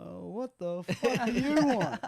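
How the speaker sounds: loud, high, slow mock outrage; exasperated outburst. Man retelling thought as security approaches, loud mock outrage. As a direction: blurt it out, fed up.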